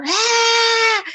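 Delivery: high, sustained yelp at normal volume; mock yell. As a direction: imitate his drawn-out cry after the hit. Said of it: "And he just yelled".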